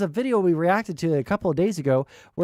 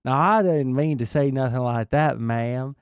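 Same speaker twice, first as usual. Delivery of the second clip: mock Southern gentleman, slightly lower; mock Southern accent; 5 semitones lower than usual. Streamer mocking Vosh's put-on Southern accent, lightly. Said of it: "start talking in a Southern accent".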